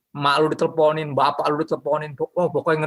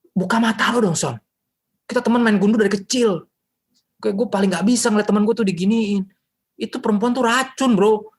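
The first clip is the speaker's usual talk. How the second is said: high, loud pleading buddy; worried friend; 8 semitones higher, 4 dB louder than usual. Acted. Friend begging Samson to drop the toxic girl, urgent. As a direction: urge him like a childhood pal.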